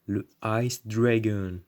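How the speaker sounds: slow, drawn-out English accent. Shown as a demonstration. Showing off a card to viewers, put-on English accent. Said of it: "j'ai pris l'accent anglais pour le faire".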